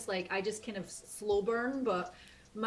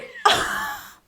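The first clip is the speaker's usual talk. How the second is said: loud high burst of laughter; giddy squealing laugh; 11 dB louder than usual. Real, not acted. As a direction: shriek with laughter. Laughs after her squeal.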